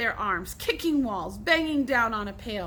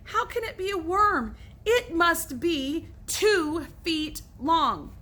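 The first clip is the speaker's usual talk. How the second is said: higher and slow, disbelieving; disbelief; 6 semitones higher, slower than usual. Acted. Billy disbelieving the huge worm, high and outraged. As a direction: stretch it out in outrage.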